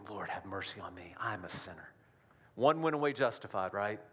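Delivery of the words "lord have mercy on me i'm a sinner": soft, lowered plea for mercy; humble plea. Retold or imitated. Tax collector softly begging for mercy. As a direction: hang your head and ask softly. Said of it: "beating himself up".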